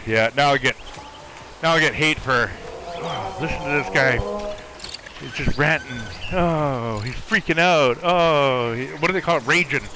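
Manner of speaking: ordinary talk, nothing marked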